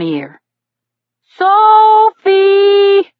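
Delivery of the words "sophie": high, loud yell after the dog; yelling a name. A narrator's reading. Cyclist yelling after his runaway puppy, loud. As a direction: shout it across the beach. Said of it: "he yelled".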